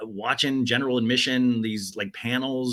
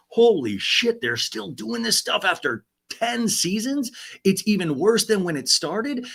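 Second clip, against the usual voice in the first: shocked fan; 9 semitones higher than usual. Retold or imitated. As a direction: gasp it out in disbelief. Acting out fans' shocked reaction.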